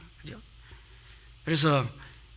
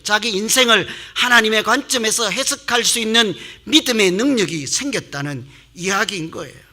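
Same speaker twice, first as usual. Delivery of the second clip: ordinary talk, nothing marked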